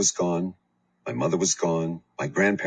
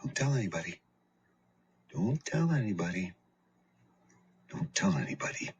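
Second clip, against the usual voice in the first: soft menacing whisper; whispered threat; 3 semitones higher, 6 dB quieter than usual. A narrator's reading. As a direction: hiss it close in the dark. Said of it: "whispered to me in the dark".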